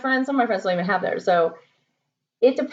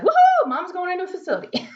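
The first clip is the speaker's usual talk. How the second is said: mock cheer; 10 semitones higher than usual. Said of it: "Come and be like".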